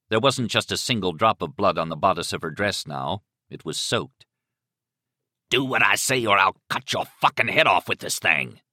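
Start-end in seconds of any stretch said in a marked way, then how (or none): 5.5-8.6 forceful killer's threat; menacing threat. Acted. Killer threatens captive with razor, menacing. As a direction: threaten hard, stressing each beat.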